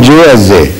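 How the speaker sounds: "j" as in jay set against "ze" with z; swapped sounds demonstrated. Shown as a demonstration. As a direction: contrast "j" and "ze", the reverse swap. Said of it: "she would only reverse the positions".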